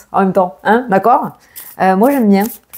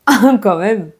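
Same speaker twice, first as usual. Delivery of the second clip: usual speaking voice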